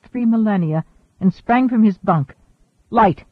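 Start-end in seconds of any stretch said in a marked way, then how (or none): 2.9-3.2 sharp order. Acted. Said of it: "he ordered".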